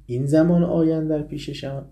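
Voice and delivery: lion rebuking the hare; emphatic repeat. Acted. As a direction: hit the repeat harder. Repeats the line with force.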